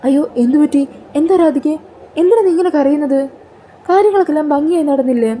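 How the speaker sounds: high, falsely worried asking; feigned concern. Retold or imitated. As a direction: play innocent and pretend to be alarmed. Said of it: "ഒന്നും അറിയാത്ത പോലെ ചോദിക്കുവാണ് കൽപ്പന".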